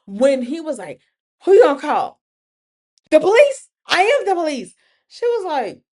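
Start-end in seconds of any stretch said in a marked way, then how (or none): 1.4-4.7 louder, higher, taunting; mocking shout. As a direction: throw it at her like a boast. Danny mocks her calling police.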